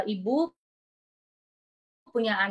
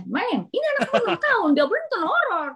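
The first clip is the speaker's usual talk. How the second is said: scolding doctor; 9 semitones higher than usual. Retold or imitated.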